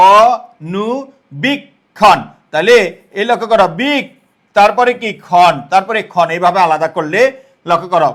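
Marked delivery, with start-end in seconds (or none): 0.1-2.2 অনুবীক্ষণ stretched very slowly, every sound held; slow and drawn out. Shown as a demonstration. Teacher slowly pronouncing the word for the class. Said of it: "আমরা বলি না অনুবীক্ষণ".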